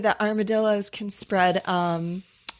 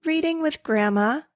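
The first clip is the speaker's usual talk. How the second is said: lightly voiced child, higher pitch; a touch of a little kid's voice; quoted little child; 9 semitones higher than usual. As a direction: lift it a little like a small child answering. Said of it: "and she said".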